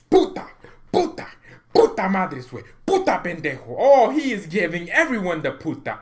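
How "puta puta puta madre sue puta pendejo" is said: high, loud mock Spanish cursing; imitating Bielsa swearing. The fan voicing Bielsa furious at the failed deal, loud mock curses. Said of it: "Marcelo El Loco Bielsa".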